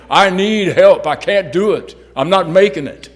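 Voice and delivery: loud, high cry for help; desperate plea. Retold or imitated. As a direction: shout it out like someone at the end of their rope. Quoted plea of a struggling person.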